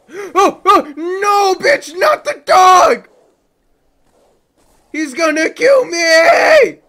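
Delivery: loud, high, frantic yelling near tears; desperate crying shout; panicked shout. Real, not acted. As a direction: scream in real panic as the fight turns. Panicked boss fight, dog attacks.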